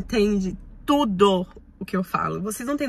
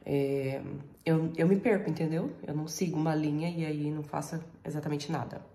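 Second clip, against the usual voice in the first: ordinary talk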